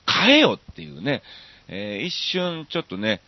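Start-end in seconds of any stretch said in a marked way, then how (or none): 0.1-0.6 punchy tsukkomi retort, louder and higher; ツッコミ. Retold or imitated. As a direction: blurt a comeback at the song lyric. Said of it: "突っ込んでしまったりも". (勢いよく突っ込む)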